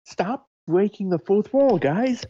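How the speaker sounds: high-pitched, playful telling-off; mock scolding. Co-host telling the others off for breaking the fourth wall, mock scolding.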